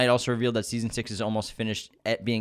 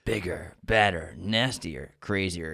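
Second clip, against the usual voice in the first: deep trailer voice; 4 semitones lower, slower than usual. Acted. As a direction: growl it like a trailer voice. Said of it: "Oh, hold on".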